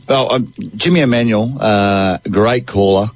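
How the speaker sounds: ordinary talk, nothing marked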